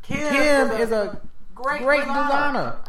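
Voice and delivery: high, slow [name] voice; slow, lifted [name] drawl; [name] impression. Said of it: "That's the Jay Farrell version".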